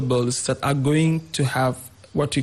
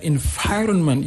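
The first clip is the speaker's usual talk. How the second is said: "environment" stressed on VI; second-syllable stress. Shown as a demonstration. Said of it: "on the second syllable".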